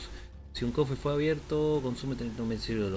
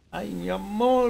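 higher, louder, slow coo; 9 semitones higher, 5 dB louder, slower than usual. Real, not acted. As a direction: gush over the cat, drawn out. Cooing at the cat.

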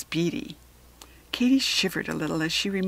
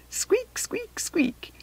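high squeaky wheel noise; squeaking wheel; 13 semitones higher, 3 dB quieter, much slower than usual. A narrator's reading. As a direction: mimic a rusty hamster wheel.

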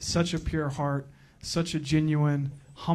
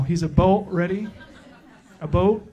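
"a boat" laughed through in a light mock Canadian accent; Canadian accent retry; 3 semitones higher than usual. Retrying the joke for the crowd, a mock Canadian accent with a laugh. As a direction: try the Canadian "about" again, giggling. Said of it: "That was terrible".

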